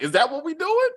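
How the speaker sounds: high, disbelieving rising question; high shocked "is that what we doing"; incredulous. Retold or imitated. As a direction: ask it in sharp disbelief. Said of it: "I was like".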